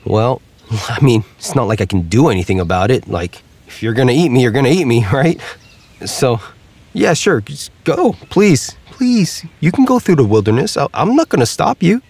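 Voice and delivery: high, jittery turkey with nervous laughs; a resigned turkey hurrying him along. Acted.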